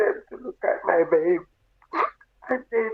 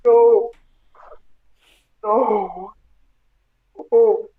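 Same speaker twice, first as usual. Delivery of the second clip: anguished cry, breaking into sobs; real grief spilling out on each no; 3 dB louder, much slower than usual. Real, not acted. Mother reliving the doctor's news, crying out in real grief. Mother retells hearing daughter died.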